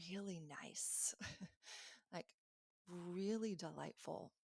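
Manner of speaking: soft whisper. Real, not acted. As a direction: breathe it out, tickled. Delighted by the kind voice.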